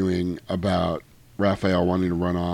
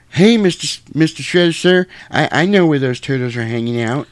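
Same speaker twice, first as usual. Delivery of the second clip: much higher, louder eager snitch voice; snitch kid; 6 semitones higher, 4 dB louder than usual. Retold or imitated. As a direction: suck up to the boss nervously. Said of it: "He's like".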